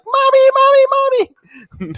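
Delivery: very high squeaky mock-panicked voice; little mouse crying out. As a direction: squeal like a tiny mouse calling for its mother. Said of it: "the little mouse being like".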